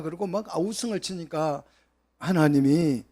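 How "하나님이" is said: ordinary talk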